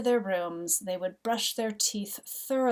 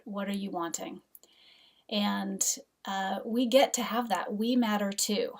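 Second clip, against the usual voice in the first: ordinary talk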